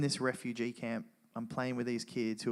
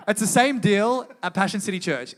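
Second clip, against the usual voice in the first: loud, raised voice projecting over the room; calling out; 8 semitones higher, 13 dB louder than usual. Real, not acted. Speaker asking the congregation about Iraq, calling out loudly. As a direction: pitch it up and out to the crowd.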